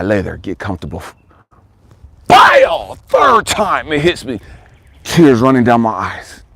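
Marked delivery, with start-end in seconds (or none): 2.3-3.0 loud, high exploding "bile"; imitated impact, burst out far above talking pitch; sudden strike noise. Imitates third cramp striking.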